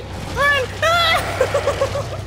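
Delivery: terrified high scream; scared horror game streamer. Real, not acted. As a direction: shriek in full panic. Fleeing monster.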